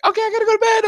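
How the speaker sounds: put-on breezy kid signing off; daughter's voice. Daughter ending the call, chirpy high voice. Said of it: "And then she's like".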